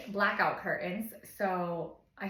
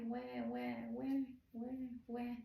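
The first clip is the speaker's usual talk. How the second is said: soft, subdued mock "wah"; fading baby cry; 3 semitones higher, 7 dB quieter than usual. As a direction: imitate baby's whines dying down. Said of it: "slowly start fading down".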